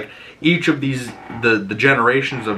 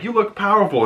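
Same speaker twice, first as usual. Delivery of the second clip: high mock neighbor; 10 semitones higher, faster than usual. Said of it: "here's like".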